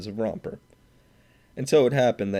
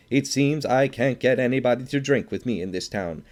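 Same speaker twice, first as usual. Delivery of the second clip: loud and high, aggrieved and sneering; indignant outburst; 5 semitones higher, 5 dB louder than usual. A narrator's reading. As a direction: needle the room, loud and sore. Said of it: "he exploded".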